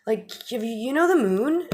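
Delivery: hammed-up enthusiastic bro; earnest excitement. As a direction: pour it out, thrilled by the discovery. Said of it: "The sun's like".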